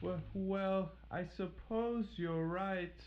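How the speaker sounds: high, slow and hesitant; Little Red gives in to the wolf, uneasy and slow. Acted. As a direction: give in reluctantly. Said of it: "uneasy about the wolf".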